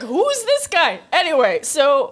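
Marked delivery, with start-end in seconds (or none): 0.0-1.0 high, amazed double take; surprised. Retold or imitated. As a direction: gasp it out in disbelief. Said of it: "I was like".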